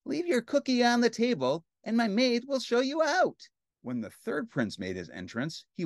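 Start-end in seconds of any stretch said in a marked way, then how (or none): 0.1-3.5 high-pitched, pouty dismissal; haughty princess. Acted. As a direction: send the suitor away haughtily. Pouting princess dismisses suitor.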